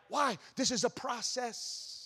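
ordinary talk, nothing marked

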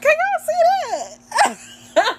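squealing high through laughter; real laughing disbelief. Real, not acted. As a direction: crack up while asking, voice shooting up. Laughing at own dispatcher impression.

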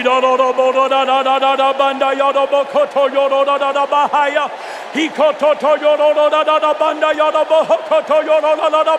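rapid ecstatic glossolalia chant; speaking in tongues. Real, not acted. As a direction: pour out tongues in fervent rhythm.

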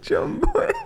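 very high, laughing Irish echo; mock Irish traveller. Echoing the traveller imitation, high Irish accent. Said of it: "It's like, just travellers".

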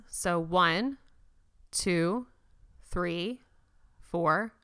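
slow, soft counting with long pauses, fading to a breath; calm breathing coach counting softly; guided breath count. Acted. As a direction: count them through each breath, slowly.

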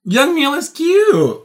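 high, slow swooning; gushing. Real, not acted. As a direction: coo it like a crush.

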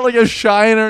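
ordinary talk, nothing marked

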